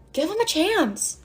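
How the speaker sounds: high, soft, slow coaxing; mock advice. Advising friends about exes as reverse psychology, high coaxing.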